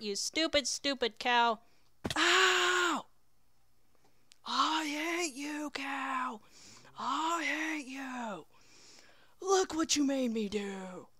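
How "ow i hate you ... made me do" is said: high, drawn-out mock-agonized cry; melodramatic wail. Player blaming the stupid cow, melodramatic high wail. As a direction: wail slowly in hammed-up hatred.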